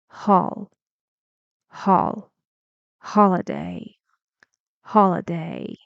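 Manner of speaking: "holiday" with HOL loud, then falling low; teacher demonstrating stress on "HOL-iday"; stressed first syllable. Shown as a demonstration. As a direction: punch the HOL in "holiday", dropping away after. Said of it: "On that syllable, the stressed one".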